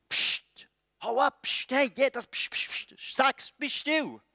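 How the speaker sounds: shushing. Acted. Crowd to the shouting blind beggar, annoyed shushing. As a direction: shoo someone into silence. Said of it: "Das ist die Reaktion der Menschen".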